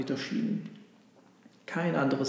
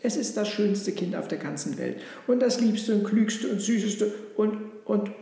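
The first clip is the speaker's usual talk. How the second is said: high, swelling adoration; gush, piling up the superlatives; proud, enthusiastic listing in a raised voice; gushing praise; 7 semitones higher than usual. Acted. Donkey gushes about the child.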